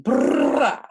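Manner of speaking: loud, high rolled gun burst; imitated gunfire. Quoting Uzi's gun line, loud imitated gunfire. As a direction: fire off a long, loud trilled brrr.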